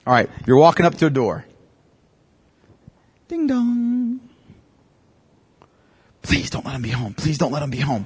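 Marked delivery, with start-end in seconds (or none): 3.3-4.2 high sing-song ding-dong like a doorbell; mock doorbell. Walking up to a door. 6.2-8.0 soft, pleading under the breath; nervous hushed plea. Pretend. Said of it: "some of you are gonna be thinking that".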